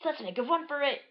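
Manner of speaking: high, loud outraged cartoon yell; hammed-up high-pitched demand; Dash's voice. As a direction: shout it as an indignant hero. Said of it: "Dash is like".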